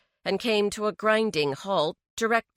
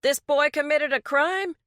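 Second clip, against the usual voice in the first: indignant disbelief; 9 semitones higher, 4 dB louder than usual. Acted. Mechanic indignant at police.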